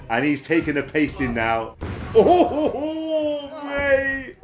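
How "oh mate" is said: high, loud disbelieving cry with a laugh; excited oh mate. Real, not acted. Commentator stunned by a huge suplex, high laughing cry. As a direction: cry out in amazed delight.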